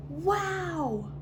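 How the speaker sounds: high gasped wow in a character voice; surprised wow. Acted. Reader voicing a character's amazement, high wow. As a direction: sound amazed, like a little robot.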